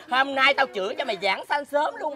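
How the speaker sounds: ordinary delivery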